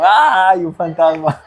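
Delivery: a playful hammed-up shriek at a ghost; mock scared yelp. A preacher mimics rough fishermen screaming at a ghost, mock fright. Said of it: "me los imagino yo ahí como gritando".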